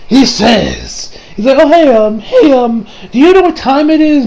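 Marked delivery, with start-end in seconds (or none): 1.6-4.2 high, perky asking voice; friendly character voice. Retold or imitated. Retelling Koichi asking the time, high friendly voice. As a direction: pitch it up for an innocent question.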